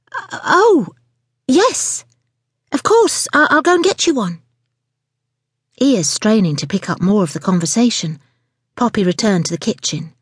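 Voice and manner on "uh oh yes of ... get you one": startled, very high-pitched reply; flustered waitress. Acted. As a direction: caught off guard, scramble to oblige. Startled waitress answers customer.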